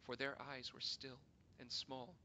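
plain reading voice

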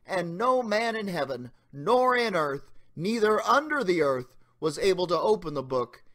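ordinary talk

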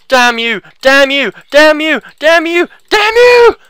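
hammy mounting outrage; escalating cursing. Pretend. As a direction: build from a shout to a shriek. Cursing the game enemy repeatedly.

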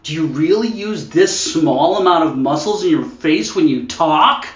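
mock American carefully straining through an accent; American over-working every word; concentrating American. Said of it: "they're really good at concentrating".

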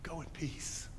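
soft, tender, fading to a whisper; whispered go in peace; tender blessing. Retold or imitated. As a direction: bless her gently. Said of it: "He says to her".

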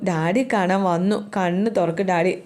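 plain reading voice

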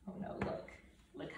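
whispered aside. Real, not acted. Parent pointing at the picture, whispered. Aside to child while reading.

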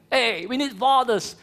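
usual speaking voice

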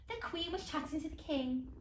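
ordinary delivery

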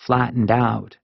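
plain reading voice

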